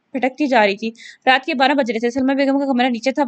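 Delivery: plain reading voice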